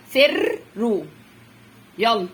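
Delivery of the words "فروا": فروا split after a long rolled ر; teacher sounding out فروا in two parts; doubled-letter demo. Shown as a demonstration. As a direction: roll the ر in فروا, pause, then finish. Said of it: "خد واحدة مع دي بقى".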